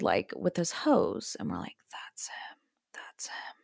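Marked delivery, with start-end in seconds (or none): 1.4-1.8 whisper. Real, not acted. Sneaking past the owner's house. 1.9-3.5 breathless whisper, no voice at all; urgent whisper. Retold or imitated. Spotting the owner, hiding in car.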